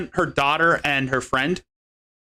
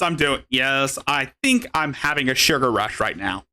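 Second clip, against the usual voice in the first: normal talking voice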